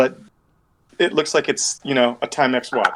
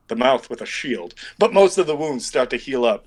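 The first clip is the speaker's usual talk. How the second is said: ordinary talk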